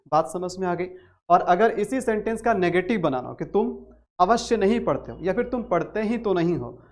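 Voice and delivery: normal talking voice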